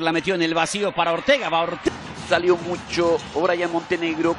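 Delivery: ordinary talk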